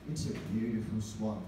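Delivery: deep, hushed wildlife-film narration; nature documentary narrator. Retold or imitated. Comedian mocking nature documentaries, deep narrator voice. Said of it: "you always see on nature documentaries like".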